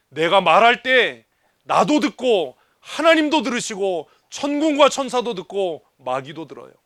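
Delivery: usual speaking voice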